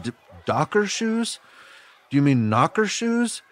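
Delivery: put-on high, puzzled retelling of a scared kid; confused question. New kid asks the bully back, high and confused. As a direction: ask it baffled, voice jumping up.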